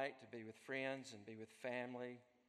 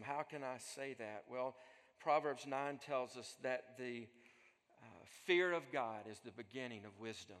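ordinary talk, nothing marked

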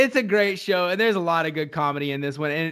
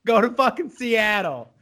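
raised, higher exasperated scolding; acted exasperation; 4 semitones higher than usual. Tony sending his sister to Seattle, mock exasperated scolding. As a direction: tell her off, acting fed up.

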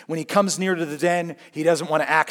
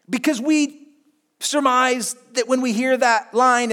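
normal talking voice

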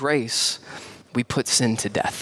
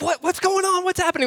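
alarmed dad. Retold or imitated. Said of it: "I'm like".

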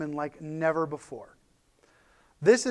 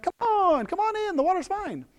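high, eager welcoming call to guests; welcoming hostess; 13 semitones higher than usual. Retold or imitated. Martha greeting Jesus at her door, high and eager. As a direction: throw the door open and wave them in cheerfully. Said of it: "She's like".

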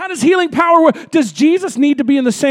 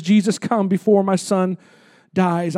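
quiet plea; 6 semitones lower, 5 dB quieter than usual. Retold or imitated. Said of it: "he says".

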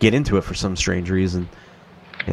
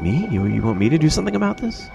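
quick, higher mock kid voice; 8 semitones higher, faster than usual. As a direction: play the startled dopey son. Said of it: "The kid was like".

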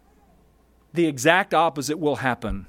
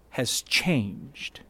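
whispered; 7 semitones lower, 6 dB quieter than usual. Acted. Pastor dramatizes David's change.

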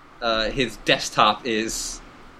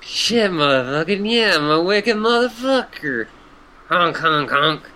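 lightly voiced stoned clown, higher; silly clown; 5 semitones higher than usual. S0 voices Gamzee, the clown troll.

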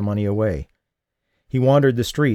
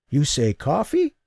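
put-on old man; 6 semitones higher than usual. Old man surprised by offer.

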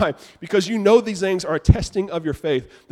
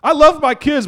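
emphatic loud declaration; 9 semitones higher, 6 dB louder than usual. Real, not acted. Preacher's emphatic outburst.

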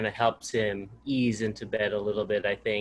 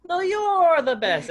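high, loud shouted comeback; playful shout; 8 dB louder, slower than usual. Retold or imitated. Dad out-shouting his son at bedtime, high and playful. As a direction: shout it back to top him. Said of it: "I hear the shouting".

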